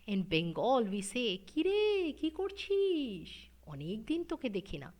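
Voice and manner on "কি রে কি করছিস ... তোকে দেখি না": high, sing-song lilt on "কি রে" and "কি করছিস"; musical Bengali lilt. Shown as a demonstration. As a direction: let "কি করছিস" swoop up musically. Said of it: "So musical".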